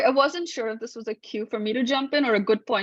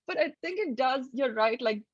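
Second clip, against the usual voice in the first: ordinary delivery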